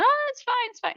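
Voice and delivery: very high squeaky oh it's fine; a panicked high-pitched brush-off; nervous reassuring. Retold or imitated. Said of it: "they try to tell him".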